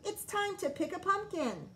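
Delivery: high eager voice; excited. A narrator's reading. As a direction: say it bouncing with joy. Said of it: "cried Froggy".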